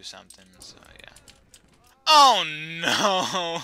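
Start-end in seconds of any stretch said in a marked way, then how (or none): 2.1-3.6 loud, high cry of dismay; alarmed cry. Real, not acted. Gamer seeing he is trapped, alarmed cry. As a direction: wail it out, much louder.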